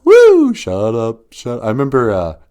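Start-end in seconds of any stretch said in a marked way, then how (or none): 0.1-0.5 sudden high woo shout; high loud woo; high whoop. Retold or imitated. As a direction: throw in a song whoop. Song whoop.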